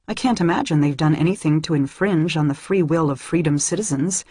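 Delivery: ordinary delivery, nothing marked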